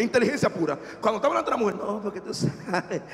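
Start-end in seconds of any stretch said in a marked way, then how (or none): 1.7-3.0 soft, slow, softer and knowing; hushed slow lovestruck tone; changed voice. Acted. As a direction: murmur it like a smitten man. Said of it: "Cambiamos hasta la voz".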